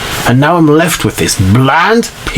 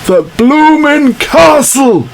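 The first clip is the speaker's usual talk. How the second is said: indignant outburst; 7 semitones higher than usual. Acted. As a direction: rant it, hands thrown up. Outraged report of the theft.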